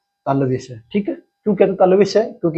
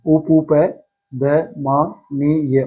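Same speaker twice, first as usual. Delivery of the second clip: very slow spelling-out; much slower than usual. Shown as a demonstration. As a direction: stretch out उपपदमानीय slowly, syllable by syllable. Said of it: "वो है उपपदमानीय".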